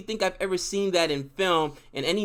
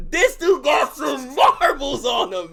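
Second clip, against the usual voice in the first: slow, higher, loud admiring shout; excited shout; 7 semitones higher, 6 dB louder, slower than usual. Real, not acted. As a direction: belt it out with awe. Excited about the scene.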